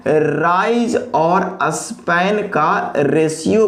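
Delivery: very slow, each word set apart, rising at the end; slow emphatic repeat. Shown as a demonstration. Teacher repeating the key phrase to students, very slowly. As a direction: drag it out so the class can follow. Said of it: "किसका ratio होता है?".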